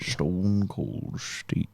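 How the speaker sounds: very slow, low, soft film star impression; Sean Connery. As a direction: draw it right down and out. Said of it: "That's, um, Sean Connery".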